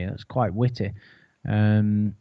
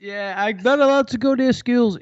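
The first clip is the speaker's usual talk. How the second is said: higher joking caller voice; mock Lorne; 8 semitones higher than usual. Co-host riffs as Lorne, higher mock voice. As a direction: play the guilty caller deadpan.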